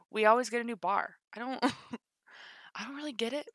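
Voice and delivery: ordinary delivery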